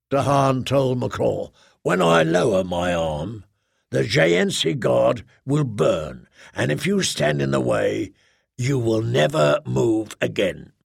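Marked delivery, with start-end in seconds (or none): 1.8-10.7 menacing commander. Acted. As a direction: promise destruction calmly. Dahan threatens Nacrol.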